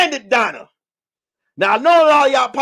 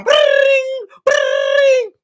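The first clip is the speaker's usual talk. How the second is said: high, trilled "bring" sound effect; video-game ring noise; 4 dB louder than usual. Retold or imitated. Sonic getting rings knocked out, high trilled effect. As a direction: mimic coins bursting out in a game.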